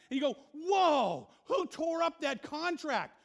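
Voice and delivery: loud, high impassioned sermon; full-force preaching. Acted. Pastor preaches against divorce courts, loud and high. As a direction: preach it hard, building heat.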